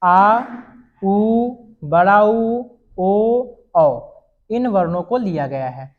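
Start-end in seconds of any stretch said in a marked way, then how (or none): 0.0-4.0 आ, उ, ऊ, ओ, औ each held out slowly and clearly; vowels demonstrated slowly. Shown as a demonstration. Teacher sounds out back vowels for students, slow and clear. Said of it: "पश्च स्वर में".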